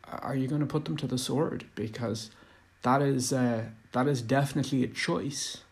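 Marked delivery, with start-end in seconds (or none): none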